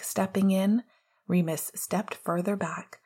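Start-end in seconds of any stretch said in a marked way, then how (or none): none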